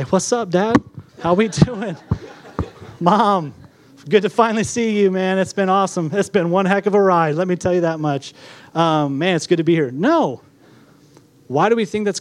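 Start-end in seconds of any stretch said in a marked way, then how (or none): 0.0-9.9 mock newborn greeting Mom and Dad, pitched up; higher, chatty comic baby; newborn talking like an adult. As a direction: greet them like an old pal fresh off a ride. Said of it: "When you were born, did you just come out".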